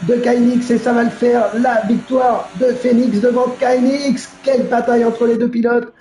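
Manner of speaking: lively live play-by-play; motorsport commentator. Real, not acted. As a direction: call the race as it happens. (commentateur de course automobile)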